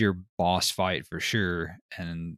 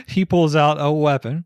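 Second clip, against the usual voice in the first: louder, higher, through laughter; amused retelling, louder and higher; laughing; 6 semitones higher, 8 dB louder than usual. Real, not acted. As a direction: say it while cracking up. Laughing at the story.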